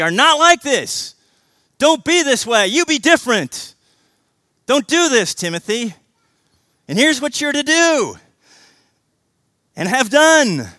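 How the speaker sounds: loud, high, driving sermon; preaching at full force. Acted. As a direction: preach it like a command.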